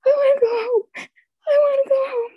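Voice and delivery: high, crying whimper, repeated; sobbing. Retold or imitated. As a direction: cry it like a scared child wanting home. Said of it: "And I'm just sobbing".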